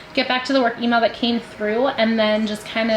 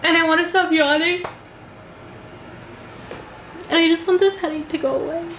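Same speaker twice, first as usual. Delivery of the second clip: higher, whiny complaining; 7 semitones higher than usual. Real, not acted. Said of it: "not to sound like a whiny baby".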